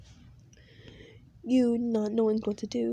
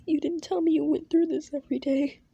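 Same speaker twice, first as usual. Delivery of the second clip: higher, pained complaint; upset girl; 6 semitones higher than usual. Acted. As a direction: accuse him through hurt.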